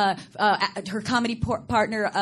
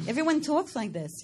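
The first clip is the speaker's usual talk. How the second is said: higher, broad Long Island accent; 5 semitones higher than usual. Shown as a demonstration. Guest teasing host with hometown talk, Long Island accent. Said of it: "That's horrible to the ear".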